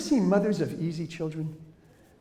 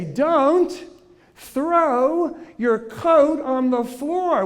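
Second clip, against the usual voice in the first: higher, louder, very slow command; mock parental order; slow instruction; 8 semitones higher, 5 dB louder, much slower than usual. Shown as a demonstration. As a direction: spell it out to a child. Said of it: "So we say".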